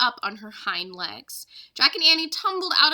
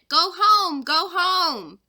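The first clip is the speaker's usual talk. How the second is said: louder, higher shouted command; yelling at the elephant to go home; 6 semitones higher, 5 dB louder, much slower than usual. Acted. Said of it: "Jack yelled again at the elephant".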